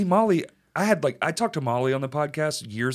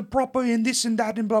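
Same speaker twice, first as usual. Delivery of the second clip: higher-pitched mock royal British; mock British accent; 8 semitones higher than usual. Podcaster imitates copying royalty, mock proper British accent. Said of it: "The modern British accent comes from royalty".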